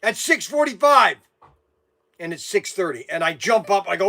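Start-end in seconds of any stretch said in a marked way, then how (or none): 0.0-1.2 imitating the wife calling out across the house; wife hollering a reminder, mock yell; retold yell. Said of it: "she yells at me".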